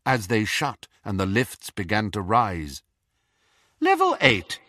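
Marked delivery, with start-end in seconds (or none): 3.8-4.6 a lightly voiced cool lift announcer; cool witch announcer. A narrator's reading. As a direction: announce it coolly, higher. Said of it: "said the witch's cool voice".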